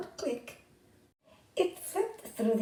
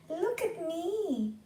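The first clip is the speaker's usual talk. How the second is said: high, slow look at me; young beetle showing off, high and slow; eager show-off; 9 semitones higher, slower than usual. A narrator's reading. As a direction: call out proudly to be watched.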